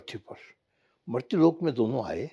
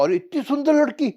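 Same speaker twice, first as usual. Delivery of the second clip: amazed gasp; 13 semitones higher, 4 dB louder than usual. Acted. Prince sees beautiful woman.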